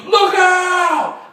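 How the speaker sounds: high, loud shout; full-volume alarmed holler; yelled warning. Acted. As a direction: yell a warning down the street. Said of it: "And I yelled".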